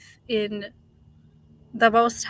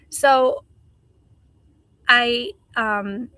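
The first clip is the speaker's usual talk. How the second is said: ordinary delivery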